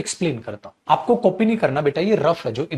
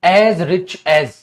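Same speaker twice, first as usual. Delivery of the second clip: slow dictation; 3 dB louder, much slower than usual. Shown as a demonstration. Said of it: "तो हमने क्या बोला?".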